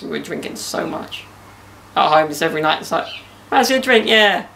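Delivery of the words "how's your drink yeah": high, loud party re-enactment; hammed-up boozy cheer; mock drunken enthusiasm. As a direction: act out an eager yes to another drink. Re-enacts nightly lockdown drinking.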